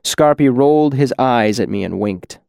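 ordinary delivery

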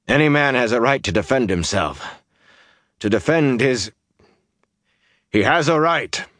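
impassioned defence; heated insistence. A narrator's reading. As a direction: insist on it firmly.

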